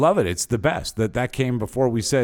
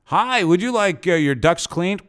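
higher, mock telemarketer pitch; crank call voice; 6 semitones higher, 4 dB louder than usual. Host crank calls a guest, mock telemarketer voice. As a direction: ham up a phony salesman. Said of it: "Why don't we crank call her?".

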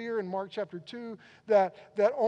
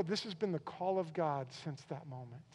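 usual speaking voice

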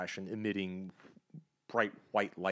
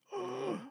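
sharp gasp; 4 dB quieter than usual. Acted. Pinky reveal, gasps.

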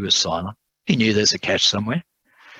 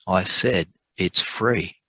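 slow, deliberate repeat; slow insistence; much slower than usual. Acted. Lawyer repeating to doubting Tom, slow and deliberate. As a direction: spell it out slowly so he believes it.

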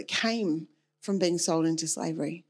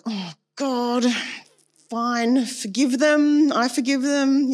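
reluctant, higher and louder, dragged out; through gritted teeth; 4 semitones higher, 6 dB louder, much slower than usual. Shown as a demonstration. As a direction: force the forgiveness out grudgingly. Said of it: "saying it through gritted teeth".

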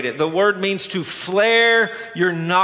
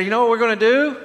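raised, eager pretend proposal; excited party suggestion; 4 semitones higher, much faster than usual. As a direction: pitch a wild idea to party guests. Acts out excited party host.

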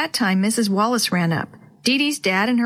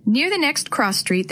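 usual speaking voice

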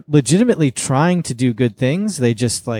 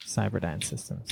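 very soft, lower repeat; hushed echo; 4 semitones lower, 17 dB quieter than usual. Real, not acted. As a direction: repeat the name far more quietly, almost to yourself. Softly echoes his own pick.

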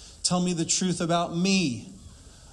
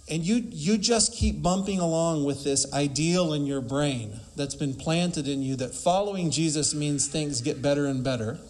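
ordinary delivery

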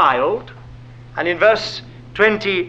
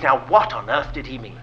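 ordinary talk, nothing marked

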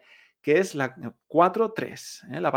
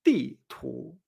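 "Ti" falling, "tu" rising like a puzzled question; dry blow, then surprise; 3 semitones lower than usual. Shown as a demonstration. Teacher summing up the simple pattern, "Ti tu" down then up. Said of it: "Golpe seco y después me extraño".

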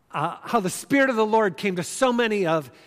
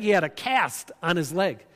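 normal talking voice

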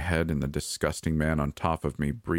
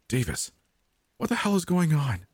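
high-pitched, panicked question into the phone; frantic; 11 semitones higher than usual. A narrator's reading. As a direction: jump up high in frantic alarm. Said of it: "whispered frantically into the phone".

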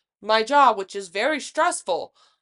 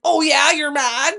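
higher, louder taunt, stretched out; mocking goad; 4 semitones higher, 6 dB louder than usual. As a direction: crow it tauntingly to provoke. Goading someone in a retold fight.

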